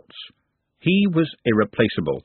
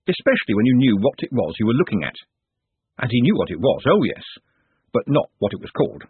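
plain reading voice

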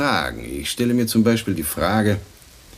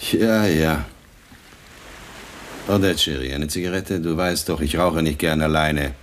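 ordinary talk, nothing marked

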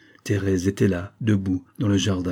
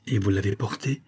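ordinary delivery, nothing marked

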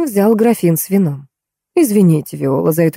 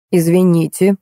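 usual speaking voice

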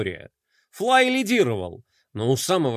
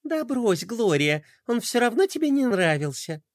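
shrugging dismissal; 5 semitones higher than usual. Acted. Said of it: "пожала плечами".

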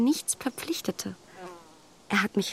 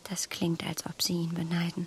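whispered, careful and soft; hushed probing question to Mr Knightley; cautious whisper; 4 semitones lower, 6 dB quieter than usual. Acted. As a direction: test him gently, barely aloud. Emma probing Knightley softly.

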